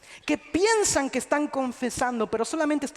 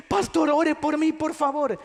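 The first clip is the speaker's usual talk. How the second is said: a touch higher and louder begging; mock pleading; 4 semitones higher, 4 dB louder than usual. Churchgoer begging the pastor, in a mock pleading voice. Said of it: "que ven a los pastores".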